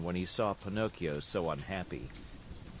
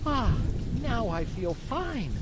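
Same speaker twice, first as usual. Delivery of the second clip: satisfied sigh; 13 semitones higher, 6 dB louder, slower than usual. Acted. Contented after eating.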